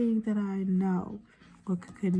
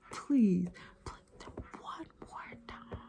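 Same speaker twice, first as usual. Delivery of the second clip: whisper; 4 dB quieter, slower than usual. Real, not acted. Whispered plea to viewers.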